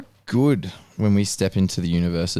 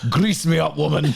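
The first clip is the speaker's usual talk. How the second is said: cartoon impression; 4 semitones higher, 3 dB louder than usual. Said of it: "That's your, that's another".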